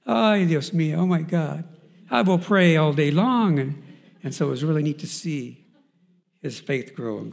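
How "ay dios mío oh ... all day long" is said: much higher and louder, slow joyful vow; overjoyed exclaim. Retold or imitated. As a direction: burst out in delight.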